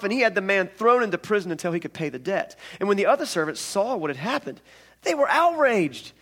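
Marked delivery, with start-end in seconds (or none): 5.0-6.1 outraged. A narrator's reading. Outrage of the servants.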